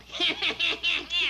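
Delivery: high mocking laugh; gloating laugh. Little man laughs as the queen fails to guess. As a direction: laugh in triumph.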